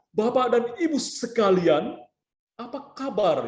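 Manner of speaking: slow, high, over-enunciated greeting; exaggerated articulation drill. Shown as a demonstration. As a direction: stretch and shape every syllable as a mouth exercise. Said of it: "boleh melakukan seperti itu". (latihan artikulasi, pelan dan dilebih-lebihkan)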